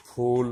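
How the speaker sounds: teacher models ফুল; ফ sound. Shown as a demonstration. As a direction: demo of ফুল with ফ. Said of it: "এফ এর উচ্চারণটা ফয়ের".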